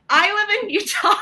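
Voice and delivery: giggling through the words; laughing. Real, not acted. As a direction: laugh while you say it. Laughs while starting the story.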